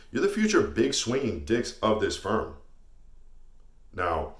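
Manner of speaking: ordinary delivery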